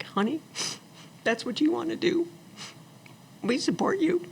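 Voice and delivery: high, soft, teary-sounding mom; a hint of a crying mother; mild tearful mom. Mom tearfully supporting her daughter, soft and high. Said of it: "She's, like, crying".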